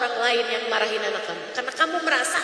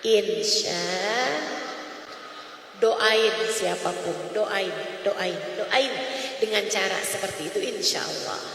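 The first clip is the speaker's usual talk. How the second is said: gentle exhortation; 6 semitones lower than usual. Acted. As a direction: ease them gently into the prayer. Soft closing exhortation.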